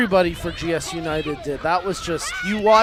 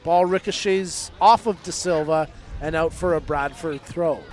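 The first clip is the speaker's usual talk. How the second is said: usual speaking voice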